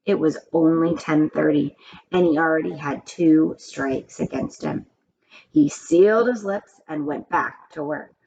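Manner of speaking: usual speaking voice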